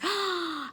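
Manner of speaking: sharp in-breath of surprise; delighted gasp. Acted. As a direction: gasp as the friends appear. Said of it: "friends see her".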